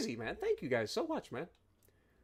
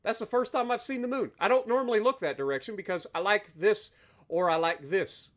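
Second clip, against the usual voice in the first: ordinary talk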